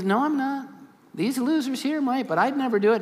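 high, cocky protest of loyalty; indignant Peter. Retold or imitated. Peter brushes off Jesus's warning, a cocky high protest. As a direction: scoff at the others, boastful.

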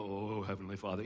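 deep, solemn mock prayer voice; voice of God. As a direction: put on a holy voice of God. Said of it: "prayed in the voice of God".

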